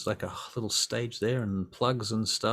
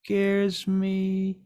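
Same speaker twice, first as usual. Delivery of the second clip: put-on high, drawn-out child whimper; scared small child; 10 semitones higher, 4 dB louder than usual. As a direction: plead like a frightened little kid. Frightened child clinging to mother.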